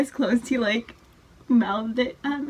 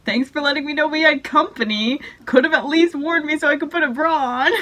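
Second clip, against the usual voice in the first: mock annoyed mom; 6 semitones higher, 5 dB louder than usual. Mom's text read aloud.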